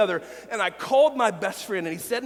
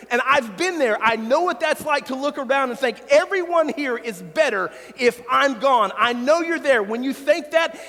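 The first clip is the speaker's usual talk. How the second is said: louder, urgent preaching to the congregation; earnest preaching; 3 semitones higher, 4 dB louder than usual. Real, not acted. Preaching with rising force.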